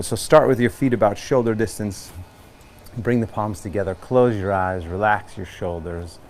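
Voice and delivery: steady, clear yoga-teacher cueing over music; yoga instruction. Acted. Instructor opening a yoga-for-runners class, calm cueing. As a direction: guide them into the pose calmly.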